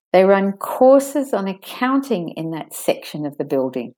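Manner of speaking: stressed vowels pulled out, the rest quick; stressed sentence demo. Shown as a demonstration. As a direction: lengthen the vowels in "accounting", "run courses", "section", "building". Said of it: "They're the ones you make the vowel slightly longer".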